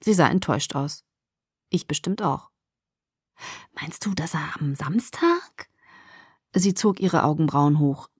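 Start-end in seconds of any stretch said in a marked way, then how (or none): 3.8-5.7 slow, half-whispered question; hushed question. A narrator's reading. Friend probing about Saturday, slow hushed voice. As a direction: ask it softly and slowly, left hanging.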